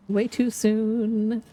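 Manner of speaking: drawn out slowly, leaning on too; slow dread. Real, not acted. Drawn-out dread. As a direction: repeats the Chicago worry slowly with dread.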